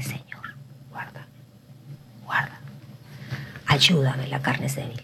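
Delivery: hushed unvoiced plea; whispered prayer. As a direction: pray under your breath for help. (oración susurrada a Dios, suplicante)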